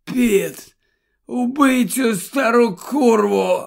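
strained words on an out-breath; winded near-whisper. A narrator's reading. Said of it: "на віддиху майже пошепки вимовляє".

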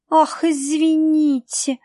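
high, slow sarcastic drawl; mocking drawl. A narrator's reading. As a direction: stretch it out mockingly. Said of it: "насмешливо протянула". (Марла насмешливо тянет девочке)

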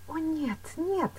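high-pitched, understated protest; anxious exclamation. A narrator's reading. Woman trying to calm herself, exclaiming. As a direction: deny it to reassure yourself. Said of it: "воскликнула она, как бы стараясь успокоить самую себя".

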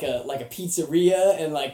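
ordinary talk, nothing marked

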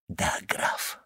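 hollow, lifeless, almost voiceless reply; inhuman voice. Acted. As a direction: drain all life out of it. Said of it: "голосом, в котором уже не было ничего человеческого".

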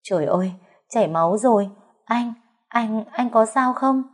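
rising, frantic worried cries; panicked. A narrator's reading. Mother and sister-in-law panicking over the bleeding brother. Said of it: "cuống quýt hết cả lên".

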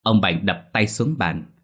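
plain reading voice

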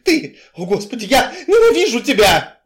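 high, loud hateful outburst; full-voiced shriek of hatred; screaming in anger. A narrator's reading. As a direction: scream it with pitch soaring. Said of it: "тоже перешла на крик".

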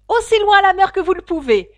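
fast, high, very loud yell; excited shout. A narrator's reading. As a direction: keep shouting, rushing the words. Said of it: "cria le griffon en faisant un bond". (crier très fort et vite)

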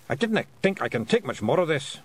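high Scottish pictsie grumble; pictsie pilot. A narrator's reading. Crashed pilot complains in character.

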